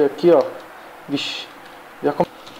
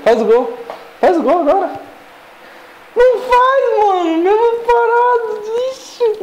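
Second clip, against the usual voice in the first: torcida aguda e aflita. Real, not acted. Torcendo pelo chute a gol.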